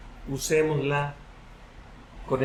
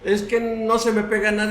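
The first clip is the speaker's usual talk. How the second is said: high-pitched mock excuse; excuse-maker; 6 semitones higher than usual. Congregant excusing himself from memorizing, high mock pleading. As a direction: plead a made-up excuse in a raised voice. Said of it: "Aquí no se vale que tú digas".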